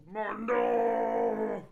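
long high drawn-out Mundo shouted loud; towers calling out. Acted. Three guard towers calling together, a long high shout. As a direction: bellow the name over the valley.

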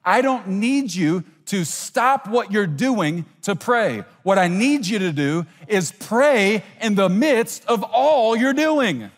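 slow, weighted delivery rising at the end; emphatic preaching. Acted. Preacher voicing what God is saying, slow emphatic preaching. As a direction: drive each phrase home, drawing out the key words. Preacher voices God's instruction.

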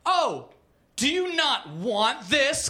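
sarcastic, stretched mock concern; mocking taunt. A narrator's reading. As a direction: taunt him with slow, exaggerated questioning. Accuser taunts begging Cosby.

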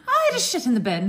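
put-on comic Irish accent with laughter creeping in. Midwife quoting the patient's confession, comic Irish accent. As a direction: quote an Irish patient, close to giggling. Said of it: "That was an Irish accent".

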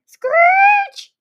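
very high squealing car screech; long high-pitched screech. A narrator's reading. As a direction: squeal it like braking tyres. Acting out the car's screech.

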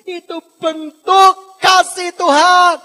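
slow, shouted, high-pitched proclamation; thundering pulpit climax; full-force preaching. Real, not acted. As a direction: stretch each word out at full volume.

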